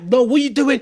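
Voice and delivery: sudden high, loud cry of protest; alarmed outburst. Retold or imitated. Coach mimics himself losing it with an emotional girlfriend, high and loud.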